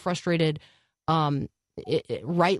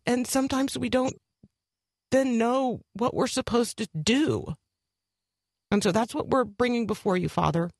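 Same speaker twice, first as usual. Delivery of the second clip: prayer. Acted. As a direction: pray aloud with long pauses. Pastor prays aloud on air.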